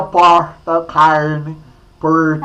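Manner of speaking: "porta", "carne", "porteira" with a retroflex caipira R. Shown as a demonstration. Teacher teasing a colleague by pulling the R, caipira R. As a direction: pull the R in "carne" the Minas countryside way. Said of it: "Ah, aí, ó".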